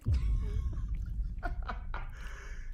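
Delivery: loud high cackle; high burst of laughter. Real, not acted. Friend cracks up at the cook-for-you line, loud high laugh. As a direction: explode in shrill laughter.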